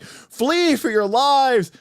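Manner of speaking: high, slow hammed-up cry of panic; mock panic. Party fleeing the unkillable Dun monster, hammed-up high panicked wail. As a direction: wail it like a cartoon coward fleeing. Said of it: "So they're like".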